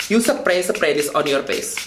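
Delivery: big-city Indonesian street-slang English; Javanese-mixed Indonesian accent. Shown as a demonstration. Vlogger teaching viewers about primer, Javanese-mixed Indonesian accent. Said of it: "the big city Javanese mixed accent".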